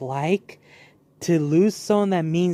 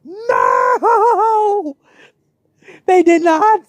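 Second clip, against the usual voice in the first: high, loud cry of disbelief; shouting no in shock; 12 semitones higher, 6 dB louder, much slower than usual. Retold or imitated. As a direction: yell it in outrage. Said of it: "I was like".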